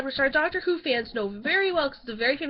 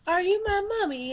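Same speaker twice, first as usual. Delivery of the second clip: high-pitched eerie child impression; sustained high singsong child voice; creepy gas-mask child; 7 semitones higher than usual. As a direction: ask it like a spooky lost child. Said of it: "And then he's like".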